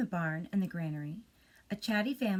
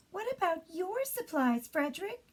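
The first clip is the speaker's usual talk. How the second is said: much higher, pleading little mice; high mouse voice; 11 semitones higher than usual. Acted. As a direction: ask up high like hungry mice. Mice asking hungry.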